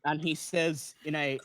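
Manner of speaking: usual speaking voice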